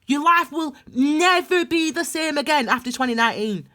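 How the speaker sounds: pressing, emphatic challenge; strong, forceful. Real, not acted. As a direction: drive it home with rising urgency. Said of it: "It's coming out strong".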